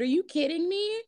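high, loud, stretched disbelief; freaked out and drawn out; shocked disbelief. Real, not acted. Shocked at the creepy photo.

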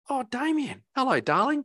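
high-pitched, effusive mock greeting; high, camp-style darling greeting; gushing greeting. Acts friend's gushing greeting at urinal.

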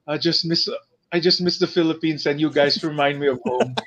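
wistful overseas worker, lightly hammed up; mock dramatic. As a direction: play a mildly melodramatic homesick listener. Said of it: "Tapos biglang naging madrama".

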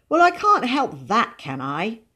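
high, emphatic protest dropping at the end; exaggeratedly patient. A narrator's reading. Boy argues with his sister, exaggeratedly patient. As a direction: reason as if with a lunatic. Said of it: "air of one arguing patiently".

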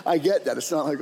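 ordinary delivery

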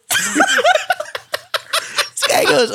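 high, breaking into laughter; can barely get the words out for laughing; giggling through it. Real, not acted. Breaking up mid-story.

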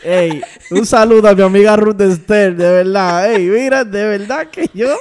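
loud, laughing greeting; calls out a hello through laughter; laughing shout-out. Real, not acted. Greeting friend Ruth Esther on air, laughing loudly.

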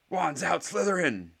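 high, loud rallying shout; battle cry. A narrator's reading. Five Slytherins answer the challenge, loud rallying shout. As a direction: yell it as a call to arms.

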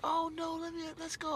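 put-on very high, soft girlish voice; coy and squeaky; girl's voice.

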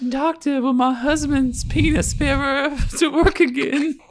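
high falsetto woman's voice; girl voice. Acted. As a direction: play the worried wife, high. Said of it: "Do your girl, girl voice".

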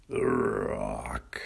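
deep, low announcer voice. Acted. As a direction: boom the title like a trailer voice. Podcast intro title, voiced.

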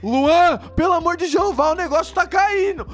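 grito agudo e alto em pânico; desespero. Retold or imitated. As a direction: gritando pro amigo segurar a parede. Pânico com a parede caindo. (grito desesperado em pânico)

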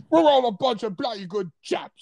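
plummy British gentleman voice; British accent. Retold or imitated. British Batman cheering on the henchmen. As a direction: play it as a jolly British chap. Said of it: "he's just like".

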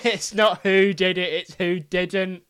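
drawn out slowly with a laugh; slow, savouring. Real, not acted. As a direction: savour each word.

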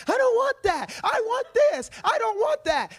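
put-on high, pouting child's voice; whiny kid. Child turning down food from a parent, high and pouting. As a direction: sulk like a picky kid refusing food. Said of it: "they look at you like".

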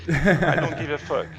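high, loud and brash with a laugh; mock the rude girl's careless swagger; exaggerated dismissive outburst; rude defiant. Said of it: "And she was like".

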